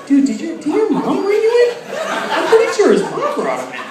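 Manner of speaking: high teasing jock voice; mock the guy needling you. Guy teasing a newcomer, in a high mocking voice.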